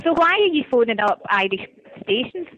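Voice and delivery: ordinary talk, nothing marked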